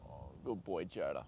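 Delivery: hushed, low and tender to the horse; soft praise. Real, not acted. Owner murmuring praise to her horse, hushed. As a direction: murmur it close, like soothing a pet.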